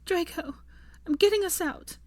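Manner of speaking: high, distraught promise; tearful resolve. A narrator's reading. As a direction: insist through tears, pitched high. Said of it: "she stuttered through her tears".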